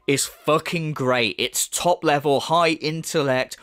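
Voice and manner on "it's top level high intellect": ordinary talk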